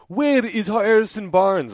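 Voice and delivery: put-on high, loud, drawn-out Italian accent; weird Italian accent. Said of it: "in his weird Italian accent".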